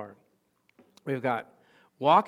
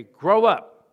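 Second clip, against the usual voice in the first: emphatic shout; 2 semitones higher, 9 dB louder than usual. Acted. Preacher rousing the congregation to grow, loud shout. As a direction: belt out "up" to rouse the room.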